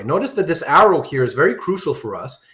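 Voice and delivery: normal talking voice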